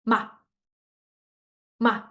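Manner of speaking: "Ma" very short and light; neutral tone, qing sheng. Shown as a demonstration. Like someone punched your belly, "Ma" very short. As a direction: cut "Ma" off quickly as if punched. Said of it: "it's like someone punch you".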